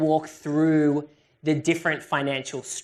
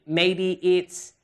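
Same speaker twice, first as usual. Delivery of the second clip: ordinary talk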